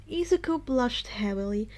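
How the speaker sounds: usual speaking voice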